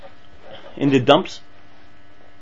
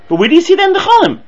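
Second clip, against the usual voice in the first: indignant challenge; 12 semitones higher, 7 dB louder, faster than usual. Retold or imitated. Acted challenge.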